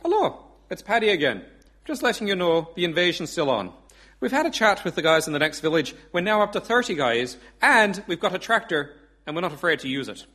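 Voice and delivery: put-on Irish accent. Pub regular updating the dictator, punchy Irish accent. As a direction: announce it proudly in an Irish accent. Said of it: "I do do an Irish accent".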